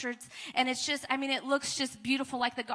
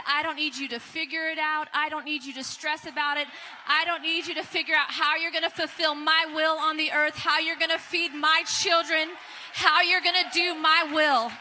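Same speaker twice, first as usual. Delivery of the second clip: voice of the Lord; 3 semitones higher, 7 dB louder than usual. Retold or imitated. The Lord telling her to stop striving, growing to a loud booming shout. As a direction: build from firm to a booming shout. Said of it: "he said".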